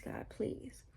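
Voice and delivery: near-whispered imitation of begging; hushed plea. Vlogger retelling her prayer for lost keys, hushed plea. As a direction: let the retold prayer drop almost to breath.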